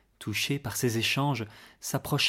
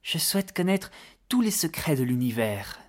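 youthful enthusiasm; 5 semitones higher than usual. A narrator's reading. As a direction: voice an excited, curious child. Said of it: "dit-il avec un enthousiasme juvénile". (enthousiasme juvénile)

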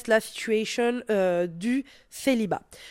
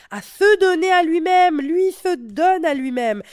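mocking emphasis; 7 semitones higher, 8 dB louder than usual. Mocking how men treat themselves.

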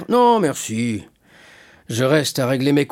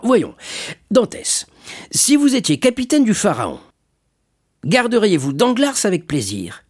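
high, animated testing question; probing shipowner; 9 semitones higher than usual. Acted. Shipowner tests the would-be captain about Danglars, probing. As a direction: press him genially for an answer.

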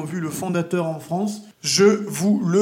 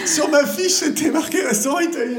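excited teasing; 8 semitones higher than usual. Real, not acted. Teasing about the summary.

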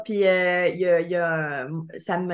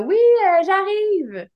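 high shout calling out; 10 semitones higher, 5 dB louder, much slower than usual. Retold or imitated. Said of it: "j'ai crié du genre".

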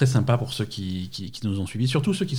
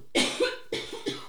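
harsh choking cough; 7 dB quieter than usual. Real, not acted. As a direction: cough as if swallowed wrong way. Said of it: "tu t'étouffes peut-être?". (toux étouffée)

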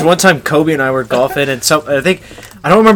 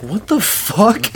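slow, stretched-out disbelief; imitating a friend's slow stunned disbelief; 3 semitones higher, 6 dB quieter, much slower than usual. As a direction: drag it out, dumbfounded. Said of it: "Josh being like".

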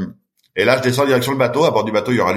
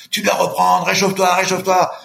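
higher, urgent yelled encouragement; team yelling; 7 semitones higher than usual. Retold or imitated. His team yells at the swimmer to keep going, higher urgent shout. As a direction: shout him back into the water, higher. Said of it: "mon équipe me gueule dessus".

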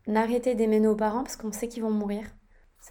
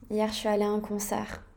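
ordinary delivery

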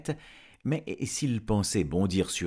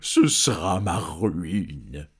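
loud, very slow moan; lamenting dragon; 7 dB louder, much slower than usual. Acted. Dragon laments the villagers' demands. (dragon qui gémit, voix forte et traînante)

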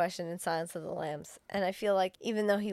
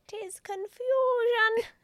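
high, slow theatrical repeat; mock high echo; 14 semitones higher, much slower than usual. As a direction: stretch it out high and silly. Echoing friend's phrase mockingly.

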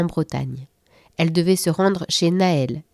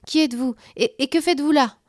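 higher, stammering fright; panicked questions; 8 semitones higher than usual. Acted. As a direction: ask in alarm, tripping over words. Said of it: "toujours affolée".

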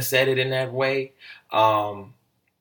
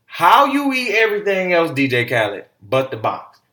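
higher and louder, hitting "How" hard; emphatic loud challenge; 6 semitones higher, 9 dB louder than usual. Real, not acted. Host calling Khaled out, loud and higher. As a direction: demand the answer straight at him. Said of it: "That's a question that we need to all ask".

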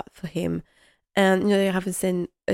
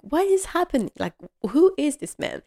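much higher, startled question; alarmed Robert; 8 semitones higher than usual. Retold or imitated. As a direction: play the bewildered lord of the house. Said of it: "he's like".

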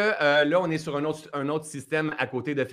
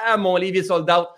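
higher, louder mock exclamation; retold exclamation; 6 semitones higher, 6 dB louder than usual. Host retelling a sold-out book, mock exclamation. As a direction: act out a sudden "Ah!" of news.

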